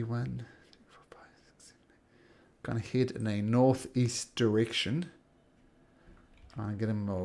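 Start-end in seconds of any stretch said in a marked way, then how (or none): 0.0-2.6 barely audible whispered count; counting under breath. Real, not acted. Game master counting to himself, whispered. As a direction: whisper it to yourself as you count.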